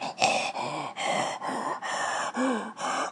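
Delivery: long breathy gasping into the phone; mock phone breathing; mock heavy breathing.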